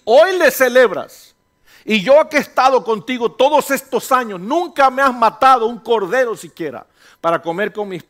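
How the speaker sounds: loud, high, impassioned sermon voice; fiery Spanish pulpit delivery; full-force preaching. Acted. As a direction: preach it out to the whole church. Preaching the prodigal son at full force.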